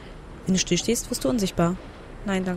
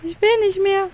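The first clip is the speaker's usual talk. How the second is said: high, louder plaintive wail; frightened protest; 11 semitones higher, 5 dB louder, slower than usual. Real, not acted. Player who wants to quit the game, frightened wail. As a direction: plead to be let out of this.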